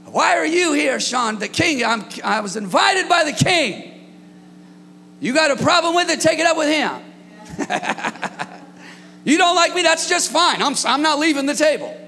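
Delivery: sassy defiant retort, voice up; defiant comeback. Acted. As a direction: stand your ground and dare them. Acted defiant reply at table.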